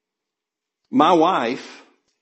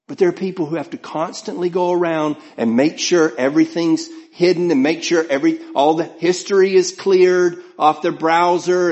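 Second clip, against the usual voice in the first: ordinary talk, nothing marked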